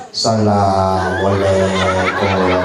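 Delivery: low, very slow monotone greeting; imitating a donation-seeker's droning call; strange drawn-out salam. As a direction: drag every syllable along one low note. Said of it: "Kenapa dah begitu caranya salam".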